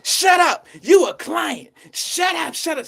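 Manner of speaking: high mock shouting, starting loudest; loud girl. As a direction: imitate her shrieked brush-off. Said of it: "She trying to get all loud".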